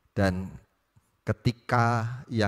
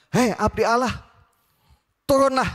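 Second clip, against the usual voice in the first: much higher, louder contemptuous yell; arrogant rude shout; 8 dB louder than usual. Acted. The captain ordering Elijah down, arrogant shout. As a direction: bark the order down at the prophet. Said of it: "kalau mungkin kita mau dramatisasi".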